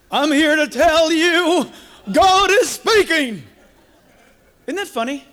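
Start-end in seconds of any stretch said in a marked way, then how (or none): 0.1-3.5 loud, high, drawn-out preacher parody; hammed-up voice-of-God proclamation; mock televangelist. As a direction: boom it out slowly like an overblown TV preacher. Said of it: "it changed just like the guys on Channel eleven".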